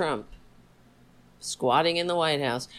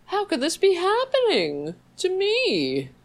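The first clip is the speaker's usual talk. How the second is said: much higher, wailing mock lament; mocking a conservative who feels betrayed, whiny; mock self-pity; 13 semitones higher, 4 dB louder than usual. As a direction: ham up the victim act. Mocking a self-pitying Republican.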